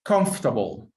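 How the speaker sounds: "Comfortable" said as three syllables, COMF-tuh-bul, slow and clear; teacher's dictation: "Comfortable" as COMF-ta-ble; slow model word. Shown as a demonstration. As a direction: model "Comfortable" for the class, kʰɑnf-tə-bəl, no middle vowel. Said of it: "I repeat again".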